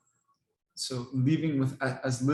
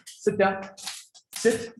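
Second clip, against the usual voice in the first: firm command; 7 semitones higher, much slower than usual. Real, not acted. Commanding eager dogs.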